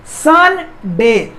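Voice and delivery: Sunday split into सन and डे, drawn out; syllable by syllable. Shown as a demonstration. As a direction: say Sunday slowly as सन, pause, डे. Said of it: "सन अलग हो रहा है और डे अलग हो रहा है".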